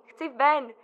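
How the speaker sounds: loud, rising, desperate demand; desperate outcry. Acted. Eulálie pleads with the doctor for freedom, crying out. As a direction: cry out that she wants to live.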